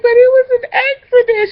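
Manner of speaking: high, loud tearful shout; hammed-up sobbing protest; crying plea. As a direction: wail the excuse. Pleading fake accident on phone.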